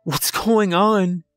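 panicked, high, slow and loud; on the verge of panic. Acted. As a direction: cry out close to panic, drawn out. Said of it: "on the verge of panic".

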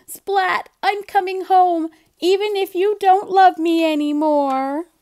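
loud high distressed cry; upset shout. Acted. Seymour calling out to Splat, hurt and loud. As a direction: wail it hurt and angry.

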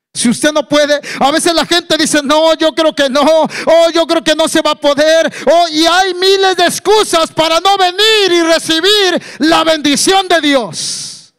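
high, loud, insistent preacher; full-force preaching. Acted. Preacher rebukes the congregation's excuses, full-force preaching.

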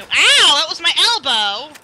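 loud, high yelp of complaint; pained outcry. Pretend. Player hit on the elbow, loud pained protest. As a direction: cry out as if just hit.

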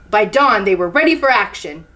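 ordinary delivery, nothing marked

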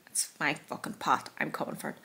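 low hushed playful threat; mock menacing whisper. Real, not acted. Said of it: "threat whispered to camera".

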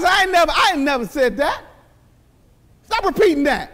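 ordinary talk, nothing marked

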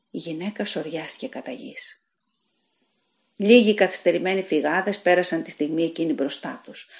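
plain reading voice

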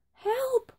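high, contained cry of alarm; cry for help. A narrator's reading. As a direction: call for help in a high witch's voice. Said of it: "cried the witch".

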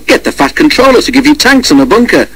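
high-pitched cheeky suggestion; perky, high little engine cheek. A narrator's reading. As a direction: pipe up high with a teasing suggestion. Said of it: "suggested Thomas cheekily".

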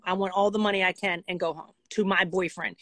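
ordinary talk, nothing marked

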